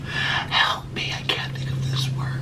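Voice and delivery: breathy whispered desperation; frustrated whisper to yourself; whispered plea. Real, not acted. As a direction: whisper it like you're begging for help. Desperate whisper searching for word.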